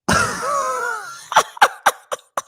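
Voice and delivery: high screaming burst of laughter; shrieking laugh. Real, not acted. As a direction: let out a shrieking laugh in shock. Reactor shocked by the clip.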